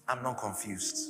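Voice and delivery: low, hushed voice of God; calm, quiet assurance. Acted. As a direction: drop low and soft to answer the dismay. God's calm answer to dismay.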